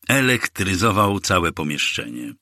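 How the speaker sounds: ordinary delivery, nothing marked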